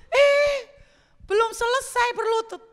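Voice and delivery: high, loud yelp of protest; startled protest. Retold or imitated. Singer reacting to God while kneeling, comic startled protest. Alarmed reaction to God's next command.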